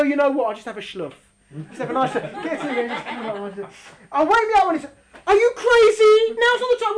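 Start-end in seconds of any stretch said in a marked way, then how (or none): 4.1-7.0 high, loud disbelief; are you crazy, loud and high; incredulous outburst. Acted. Mocking sleeping Jonah incredulously.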